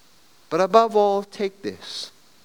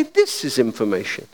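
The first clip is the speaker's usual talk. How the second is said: lower, calm operator on the line; a lightly voiced switchboard operator; 9 semitones lower than usual. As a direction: answer like the telephone operator. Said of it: "And a voice would say".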